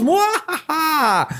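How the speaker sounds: slow high mock evil cackle; hammed-up evil laugh, drawn out; villain laugh. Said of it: "tratando de verse malo". (risa malvada exagerada)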